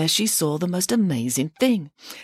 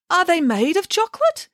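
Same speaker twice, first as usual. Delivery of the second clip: put-on eager child voice; 13 semitones higher than usual. Bramwellio asks hopefully.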